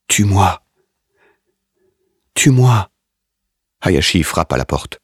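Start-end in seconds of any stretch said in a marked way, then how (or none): 0.1-0.7 a mild sobbing plea. A narrator's reading. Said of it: "se met à sangloter". 2.3-2.9 higher, louder desperate cry; sobbing scream. A narrator's reading. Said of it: "se met à sangloter".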